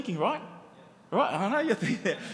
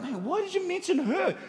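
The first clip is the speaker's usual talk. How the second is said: lightly voiced reader, pitched higher in disbelief; 9 semitones higher than usual. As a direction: play a puzzled reader asking why, lightly. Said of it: "I'll be like".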